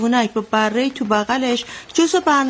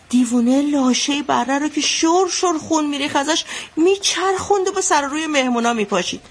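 usual speaking voice

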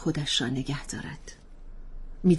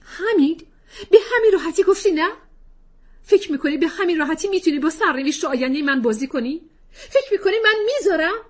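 much higher, louder, fast outraged cry; furious challenge; 13 semitones higher, 8 dB louder, faster than usual. Acted. Wife outraged husband says no, furious. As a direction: hurl it back at him.

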